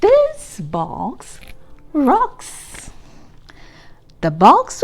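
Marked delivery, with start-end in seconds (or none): none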